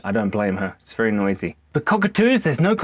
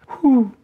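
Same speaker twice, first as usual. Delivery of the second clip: high loud hoo; owl hoot; 12 semitones higher, 5 dB louder than usual. Shown as a demonstration. Owl hooting at nighttime. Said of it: "they hoot".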